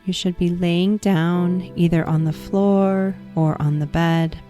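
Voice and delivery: ordinary delivery, nothing marked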